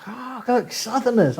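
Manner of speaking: high-pitched exclamation at a novelty; mock astonishment. Back south spotting fellow Southerners, mock astonished. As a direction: point out strangers in pretend amazement.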